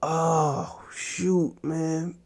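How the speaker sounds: normal talking voice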